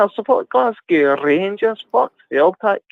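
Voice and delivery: high, hammed-up Glaswegian fan; mock Glasgow accent; Scottish accent. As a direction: declare it in put-on Scots. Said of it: "talk with my Scottish accent".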